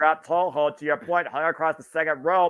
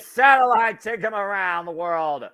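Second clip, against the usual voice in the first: excited call; 6 semitones higher, 4 dB louder than usual. Real, not acted. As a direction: call the move with rising excitement. Big move called live.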